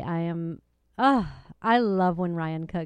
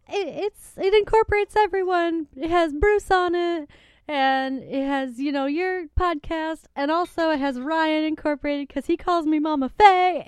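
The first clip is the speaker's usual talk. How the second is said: much higher, gushing take on her mom; imitating her excited mom; 9 semitones higher, 4 dB louder than usual. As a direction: play her mom bubbling over about the mug. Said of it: "She's like".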